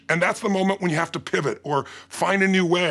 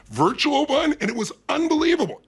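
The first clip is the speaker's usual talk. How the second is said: excited; 8 semitones higher than usual. Real, not acted. Excited about the event's success.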